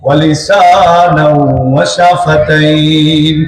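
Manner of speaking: slow melodic Arabic chant; Quran recitation. Acted. Preacher reciting a verse to the gathering, slow chant. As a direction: draw the verse out like a qari.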